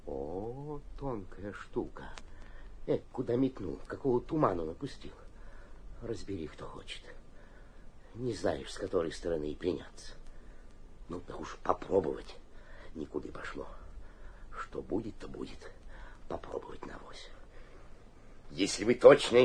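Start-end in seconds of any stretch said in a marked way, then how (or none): 0.1-15.7 aside under the breath. Acted. Mayor's aside to himself. 16.3-17.3 whispered aside. Acted.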